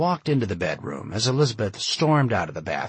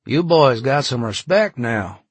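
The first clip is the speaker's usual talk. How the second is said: louder, slightly higher character voice; lightly voiced landlord, loud and emphatic; Southern landlord; 4 semitones higher, 7 dB louder than usual. Landlord's line acted in narration.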